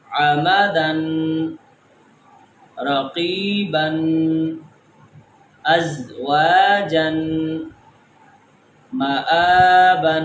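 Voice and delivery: آمدن read slowly, sounding the final n; slow reading drill. Shown as a demonstration. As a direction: read each word slowly with its nunation. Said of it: "نون کی آواز نکالیں گے".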